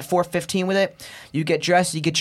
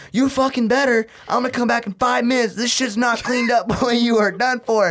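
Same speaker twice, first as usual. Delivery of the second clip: angry yell; 6 semitones higher than usual. Retold or imitated. Marine threatening recruit.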